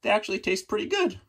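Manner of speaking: high, fast cheerful dog voice. Acted. Translated dog answering, high quick cheerful voice. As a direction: chirp it out like a happy puppy. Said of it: "The translation down here".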